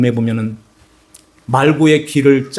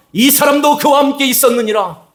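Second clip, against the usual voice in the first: insistent accusation; 12 semitones higher than usual. Acted. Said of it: "내가 봤다?".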